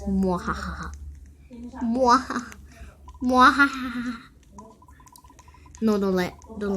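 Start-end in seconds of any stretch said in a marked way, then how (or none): 0.0-4.2 hammed-up mwahaha cackle; evil laugh. Kid playing villain, hammed-up evil cackle. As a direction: give a mock villain cackle.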